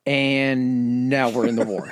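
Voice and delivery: slow, laughing Italian-dictator voice; a hammed-up Mussolini, savoring it; mock Mussolini. Podcast host mocks Italy joining the war, slow and laughing.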